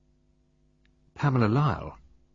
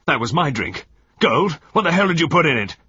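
furious accusation; 7 semitones higher, 8 dB louder than usual. A narrator's reading. Husband accuses Gold.

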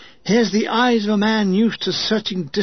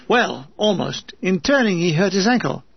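ordinary delivery, nothing marked

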